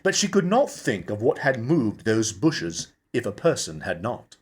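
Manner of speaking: ordinary delivery, nothing marked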